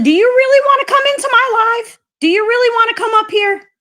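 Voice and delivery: high-pitched, indignant taunt at her; incredulous high confrontation; angry challenge. Real, not acted. Confronting Risa who joined live.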